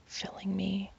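usual speaking voice

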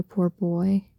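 usual speaking voice

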